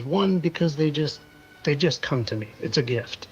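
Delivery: ordinary talk, nothing marked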